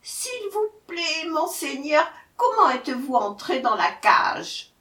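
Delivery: high, hesitant question; timid pleading jackal. A narrator's reading. Jackal asking the tiger about the cage, timid.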